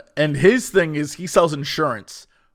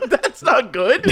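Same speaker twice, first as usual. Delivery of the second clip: laughing; 8 semitones higher, slower than usual. Retold or imitated. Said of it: "I was like".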